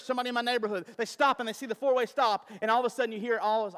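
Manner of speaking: usual speaking voice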